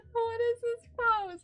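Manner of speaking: high, squeaky laughing question; laughing at the cat's odd pose. Real, not acted. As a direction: ask through helpless laughter.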